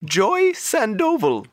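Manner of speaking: Joy Sandoval said slowly, each syllable clear; high lady voice, slow and careful; slow clear repeat. Shown as a demonstration. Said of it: "One more time".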